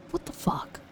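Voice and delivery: unvoiced stunned curse; stunned mutter. Real, not acted. Reacting to Paimon's remark, a stunned whispered curse. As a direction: breathe it out in shock.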